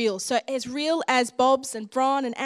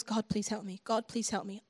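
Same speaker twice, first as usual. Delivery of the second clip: quiet plea; 3 semitones lower, 8 dB quieter than usual. Retold or imitated. Retells her desperate repeated prayer.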